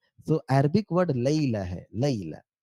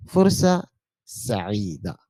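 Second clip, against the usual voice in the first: slow teaching demo; 5 semitones lower, 3 dB louder, much slower than usual. Shown as a demonstration. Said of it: "Nice to meet you को हम कहेंगे फुर्सा सईदा".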